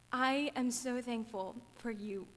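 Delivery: usual speaking voice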